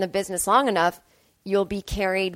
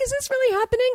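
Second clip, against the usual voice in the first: very high, giddy disbelief; high excited squeak of a question; retold excitement; faster than usual. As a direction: replay being thrilled, pitched way up. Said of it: "I was so excited".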